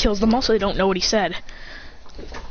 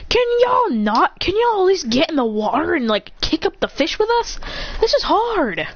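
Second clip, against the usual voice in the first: exasperated; 8 semitones higher than usual. Acted. Kristen nagging the others to help fish, high exasperated whine. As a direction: whine at them to pitch in.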